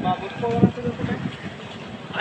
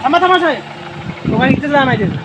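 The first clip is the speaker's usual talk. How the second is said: calling out; 6 semitones higher than usual. Acted. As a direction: shout after the official, pitch raised high. Calling the officer to stop.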